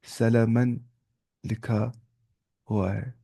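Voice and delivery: low, soft, with long gaps; subdued, halting writing-pace delivery; word-by-word dictation. Shown as a demonstration. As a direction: dictate one word at a time.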